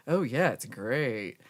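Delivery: drawn-out, heavily sarcastic fake agreement; sarcasm voice. Shown as a demonstration. Streamer demonstrating how Americans do sarcasm, exaggeratedly sarcastic. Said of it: "like, a sarcasm voice when they do sarcasms".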